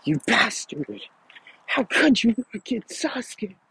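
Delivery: enraged yelling. A narrator's reading. Sakura lashing out at Naruto over Sasuke, high angry yell. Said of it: "yelling at him".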